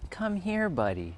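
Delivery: lightly voiced neighbor calling his dog, high on here; a hint of the neighbor's sing-song come here; imitating a neighbor. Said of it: "That's how that guy speaks".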